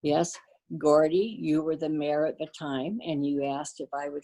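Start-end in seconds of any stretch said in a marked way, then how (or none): none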